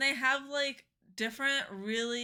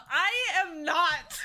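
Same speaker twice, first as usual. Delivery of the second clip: loud, higher, through laughter; laughing denial; 10 semitones higher, 7 dB louder, slower than usual. Real, not acted. Streamer laughing off her death count to chat, laughing. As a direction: protest while cracking up.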